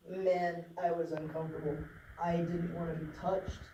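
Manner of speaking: ordinary talk, nothing marked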